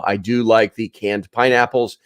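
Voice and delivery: usual speaking voice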